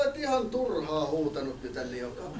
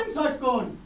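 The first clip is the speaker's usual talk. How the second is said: flustered villager woman; 6 semitones higher, slower than usual. Acted. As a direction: squeal in a panic like a fussy villager.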